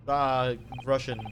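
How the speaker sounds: put-on loud, boastful Russian accent; Russian sailor accent. Sailor boasting about the first class area, Russian accent. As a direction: puff up like a guard in Russian accent.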